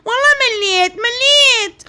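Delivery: put-on a fed-up teen sobbing her complaint; whiny crying daughter. Child complains about her studies.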